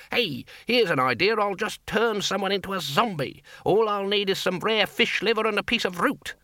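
high-pitched sarcastic rant; mock a ridiculous experiment, voice climbing high; scornful, singled-out numbers in a high querulous voice; nasty. A narrator's reading. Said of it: "said the Senior Wrangler nastily".

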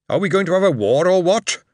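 a blunt, puzzled troll asking outright; troll soldier. Retold or imitated. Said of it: "and he said".

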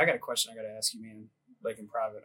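low, hushed request for privacy; subdued. Retold or imitated. Airman asking his sergeant for a private word, subdued. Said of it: "Ibad is, like, super quiet".

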